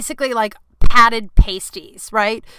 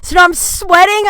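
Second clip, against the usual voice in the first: ordinary delivery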